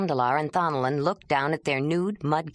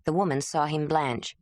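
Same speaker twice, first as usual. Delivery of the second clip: ordinary delivery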